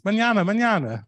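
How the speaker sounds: high sing-song mañana said through a smile; smiling brush-off. Retold or imitated. Locals answering with a big smile, cheerful high sing-song. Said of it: "with a big smile on their face".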